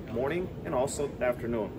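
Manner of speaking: usual speaking voice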